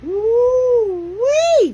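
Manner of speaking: loud, high woo-wee cry; high whooping exclamation. Real, not acted. Exclaiming at the attacks.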